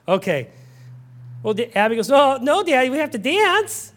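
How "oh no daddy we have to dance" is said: put-on higher, eager child voice; a hint of an insistent little daughter; lightly voiced little girl. Little Abby correcting Daddy, lightly voiced and eager.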